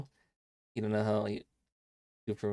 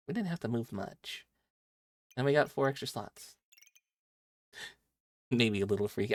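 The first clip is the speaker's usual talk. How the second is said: ordinary talk, nothing marked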